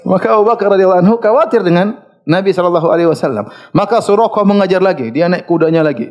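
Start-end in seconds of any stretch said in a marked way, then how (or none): none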